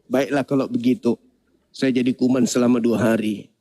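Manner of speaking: very low, mock-solemn God voice; deep divine pronouncement; God agreeing. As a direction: grant the request in a deep put-on voice. God answers the germ's complaint.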